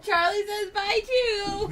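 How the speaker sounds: loud laughing goodbye; laughing shout. Real, not acted.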